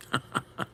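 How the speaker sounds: brief, soft chuckle; little voiceless laugh; short laugh. Real, not acted. Streamer after own joke about being a shill, soft chuckle.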